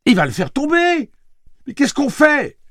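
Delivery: very high, loud alarmed shout; cri affolé. A narrator's reading. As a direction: cry out in panic for someone to act. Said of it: "cria-t-elle". (cri d'alarme très aigu)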